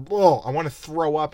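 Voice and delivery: a touch of theatrical retching disgust; mock nausea. Real, not acted. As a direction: gag at the sappiness. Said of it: "reacting to cheesy dialogue".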